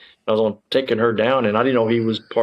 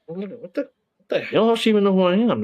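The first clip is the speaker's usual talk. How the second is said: what the hell, thrown completely off guard; stunned disbelief; 8 semitones higher than usual. Retold or imitated. Officer hearing his name on the call, stunned high disbelief. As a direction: pitch jumps high in shocked disbelief.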